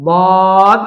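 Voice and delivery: বদ loud, with a long held vowel; drawn-out letter name. Shown as a demonstration. Teacher naming a letter for learners, drawn out. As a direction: stretch the vowel in বদ. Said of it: "টেনে বলতে হবে".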